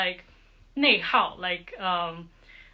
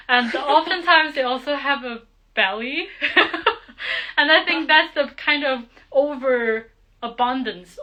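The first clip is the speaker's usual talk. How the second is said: louder, higher, with real giggles breaking in; amused, laughing; 5 semitones higher, 7 dB louder than usual. Real, not acted. Teacher joking to students about men's bellies, laughing. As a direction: talk through a laugh.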